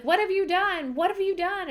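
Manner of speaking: high, shocked mock wail of grief; half-acted horror, voice jumping way up; horrified cry. Friend killed in front of her, horrified cry.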